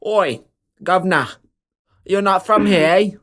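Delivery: a hammed-up British kangaroo; little joey in the pouch challenging strangers, mock British accent. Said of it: "don't ask why he's British".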